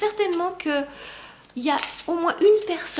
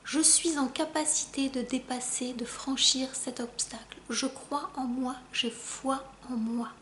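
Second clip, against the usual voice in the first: mantra dit doucement; 2 semitones lower, 7 dB quieter than usual. Shown as a demonstration. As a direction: murmur it gently, like a mantra to repeat to yourself. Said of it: "je vais parler plus doucement". (mantra murmuré tout bas)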